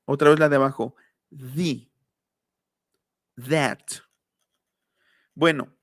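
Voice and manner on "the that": "The" and "That" with tongue between teeth; separate, careful voiced TH; interdental TH. Shown as a demonstration. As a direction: model the English th in The, That. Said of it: "Interdental quiere decir".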